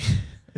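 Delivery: breathy chuckle at his own joke; corny dad laugh. Real, not acted. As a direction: laugh at your own pun, unvoiced. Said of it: "you're laughing at your own jokes?".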